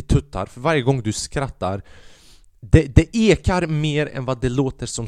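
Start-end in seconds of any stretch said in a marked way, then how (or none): none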